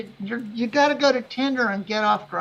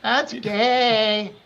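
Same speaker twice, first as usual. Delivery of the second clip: imitating unimpressed women; 5 dB louder, much slower than usual. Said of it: "all the women take one look at you and say".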